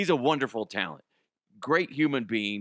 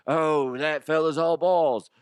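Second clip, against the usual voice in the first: loud, high, hollered catchphrase; catchphrase exclamation; 6 semitones higher, 6 dB louder than usual. Acted. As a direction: holler it like a big play call. Re-enacts saying his catchphrase.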